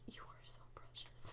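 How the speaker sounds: soft, breathy whisper of adoration; whispered cooing. Retold or imitated. Mother whispering to her wailing son about how precious he is. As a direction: whisper it like he's too precious. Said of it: "cute and almost funny".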